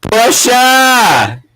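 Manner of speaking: high, drawn-out cry of the name; exasperated call. Pretend. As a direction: call her out as if across a room. Calls out Portia in exasperation.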